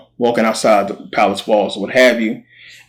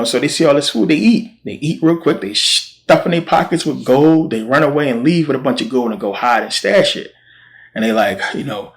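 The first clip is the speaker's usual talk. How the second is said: ordinary talk, nothing marked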